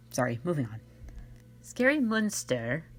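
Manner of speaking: normal talking voice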